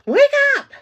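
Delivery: sudden high, loud shout; shouted wake-up call. Real, not acted. Streamer calling out to wake up, high shout. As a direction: yell it like an alarm going off.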